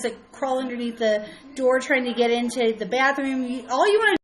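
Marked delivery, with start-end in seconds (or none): 3.5-4.2 exasperated venting. Real, not acted. Venting about bathroom privacy.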